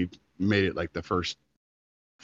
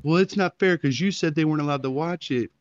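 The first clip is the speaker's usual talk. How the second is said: higher, mock-indignant ex-wife; mocking whiny complaint; 9 semitones higher, 4 dB louder than usual. Ex-wife protesting on the phone, mock whiny voice. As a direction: parrot her complaint in a put-on whine.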